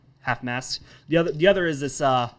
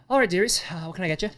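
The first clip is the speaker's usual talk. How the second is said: barmaid voice; 6 semitones higher, faster than usual. Acted. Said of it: "She's like".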